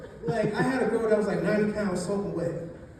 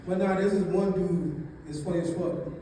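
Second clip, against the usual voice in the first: normal talking voice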